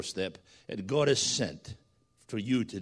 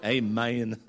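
drawn-out two-beat Southern "Amen"; imitated Southern accent; 4 semitones lower than usual. Preacher repeating little Judah's word, in a Southern accent. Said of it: "that's as son as you're gonna get".

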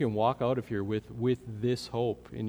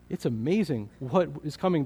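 ordinary talk

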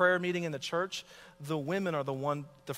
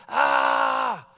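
loud, low yell after smashing a can; drunken yell; 12 semitones lower, 11 dB louder than usual. Retold or imitated. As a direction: bellow like a drunk guy on the beach. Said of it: "and he goes".

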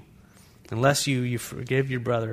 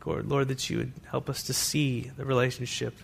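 closing prayer; 6 dB quieter than usual. Acted. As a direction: pray quietly over the room.

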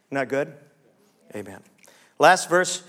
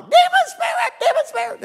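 much higher, louder mock cry of panic; mock deliverance cry; 5 dB louder than usual. Pastor mocking a congregant fleeing in panic, high hammed yell. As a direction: ham up a frantic exorcist yelling. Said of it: "freak out and run out".

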